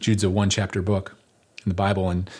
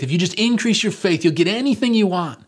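tag-along promise; 9 semitones higher, 4 dB louder than usual. Acted. Mimics a poor-theology faith promise.

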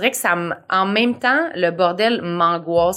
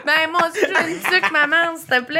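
en riant; 8 semitones higher than usual. Real, not acted. Taquine l'invitée comme une mère.

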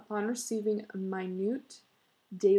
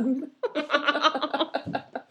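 real laughter, high and louder; giggling fit while reading; long laugh; 6 semitones higher, 5 dB louder than usual. Real, not acted. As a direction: break up laughing mid-story. Reader laughs at own reading.

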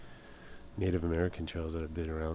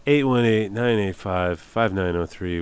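announcer read; 8 dB louder than usual. Acted. Radio host reads call-in number.

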